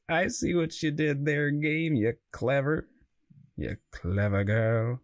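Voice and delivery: put-on slow, drawn-out clever girl; mock movie quote. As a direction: savour each word like a movie hunter. Quoting Jurassic Park line slowly.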